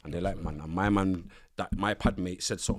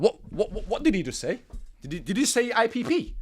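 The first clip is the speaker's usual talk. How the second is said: acting lost and confused, pitched up; bewildered what-what-what; baffled; 10 semitones higher than usual. Confused about prison term.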